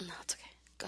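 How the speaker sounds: hushed, almost whispered; whispering. Real, not acted. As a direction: whisper it after coughing. Coughing, speaks in a whisper.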